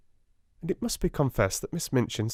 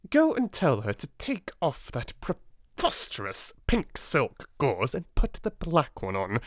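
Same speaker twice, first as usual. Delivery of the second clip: cold command; 5 semitones higher than usual. A narrator's reading. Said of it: "Miss Minchin commanding".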